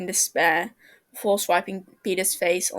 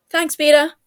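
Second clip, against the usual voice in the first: louder, higher hurried shout; 7 semitones higher, 9 dB louder than usual. A narrator's reading. James shouting thanks to Peter while scrambling. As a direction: call it out while rushing off. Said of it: "James shouts".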